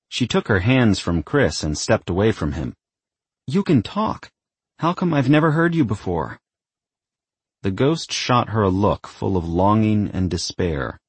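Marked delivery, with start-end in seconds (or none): 3.5-6.4 much higher, amazed daughter's voice; astonished. A narrator's reading. Daughter hearing her ghost father talk, high-pitched astonishment.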